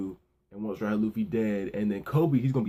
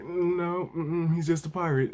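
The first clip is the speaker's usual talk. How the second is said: high-pitched mock-innocent denial; coy denial; 6 semitones higher than usual. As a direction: play it evasive, voice lifted high. Retold coy denial to questioner.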